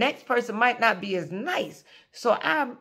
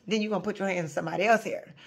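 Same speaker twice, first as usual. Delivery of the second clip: fast aside; 4 semitones lower, 6 dB quieter, much faster than usual. Shown as a demonstration. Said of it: "if I say it nicely".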